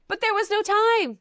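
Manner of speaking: high, loud mock-indignant cry; exclaimed protest. Host defending the wedding-day comment, loudly protesting. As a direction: burst out in exaggerated defence.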